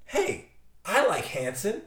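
lightly voiced slow thinker; slow character voice. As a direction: muse it slowly.

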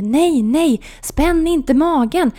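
high, loud and slow anxious plea; increasingly worried. Retold or imitated. Nurse begging patient not to tense, worried plea. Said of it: "säger hon allt mer oroligt".

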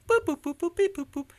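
high mock boop beat sound; boop-boop vocal beat, up high; high mouth beat noises. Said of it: "He's like".

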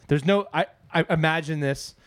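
usual speaking voice

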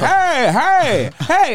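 high, drawn-out calls from afar; imitated yelling. Man calling to his neighbor from the car, mock yelling. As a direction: holler for someone up the street. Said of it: "he starts yelling".

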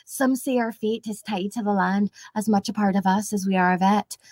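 put-on Scottish-accented storybook narration; Merida. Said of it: "Like Merida, just, like, brave".

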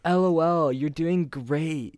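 high, loud gushing comment voice; mock gushing girl. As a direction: gush out a fake-sweet compliment. Mocking girls' Instagram comments.

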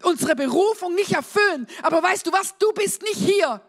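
high-energy preacher rallying the congregation; impassioned preaching. Real, not acted. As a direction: preach it with rising conviction, punching key words. Preaches at full force.

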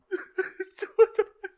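squealing giggle; high-pitched laugh. Real, not acted. Laughs at himself.

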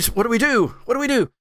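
ordinary talk, nothing marked

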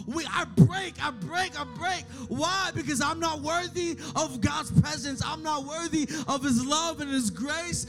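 fervent preaching. Acted. Pastor confesses his brokenness to the church, fervent preaching. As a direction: preach it at full force, voice lifted. Preacher building to full force.

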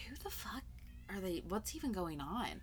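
confused whisper. Retold or imitated. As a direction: whisper it, baffled. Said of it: "they're like".

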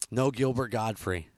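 normal talking voice

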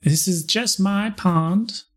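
slow, high Southern drawl; Southern-accented line for the nixie; a hint of a Southern belle. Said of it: "Slipping into Southern".

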